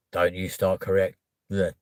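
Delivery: ordinary delivery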